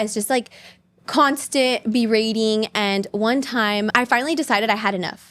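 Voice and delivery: normal talking voice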